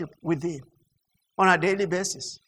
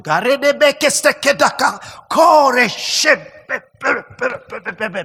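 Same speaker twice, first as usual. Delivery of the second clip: loud rattled syllables fading into a sputter; mock tongues prayer; 3 semitones higher, 3 dB louder, slower than usual. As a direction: start forceful, peter out into stutters. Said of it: "Instead of waking up in the morning".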